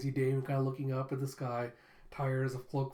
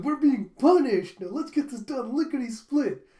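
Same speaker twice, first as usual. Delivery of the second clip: much higher, much louder gleeful flunky; overeager cheer; 9 semitones higher, 10 dB louder than usual. Retold or imitated. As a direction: sell the punishment like a treat. Flunky cheerfully accepts punishment.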